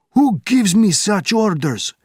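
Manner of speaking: high, loud, slow indignant shout. Acted. Captain challenging Benjamin, shouting. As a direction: shout back in defiance. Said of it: "shouted the officer".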